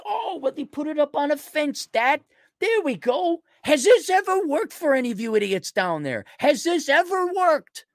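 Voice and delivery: high, strained incredulous rant; exasperated disbelief. Real, not acted. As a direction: demand it in high-pitched exasperation. Exasperated at painted fence slogans.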